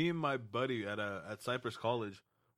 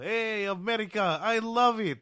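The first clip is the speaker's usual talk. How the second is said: loud, high, drawn-out cheer; hammed-up Russian fan calling out, much higher and louder; excited Russian guys; 11 semitones higher, 8 dB louder, slower than usual. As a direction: whoop it up as a Russian guy. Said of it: "like, Russian guys come out".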